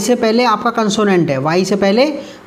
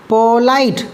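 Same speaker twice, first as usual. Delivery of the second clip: Polite broken after पो, two beats; two syllables; 3 semitones higher, much slower than usual. Shown as a demonstration. Teacher showing two syllables, polite broken in two. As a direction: split polite into पो and light. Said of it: "दो बार में टूटा है".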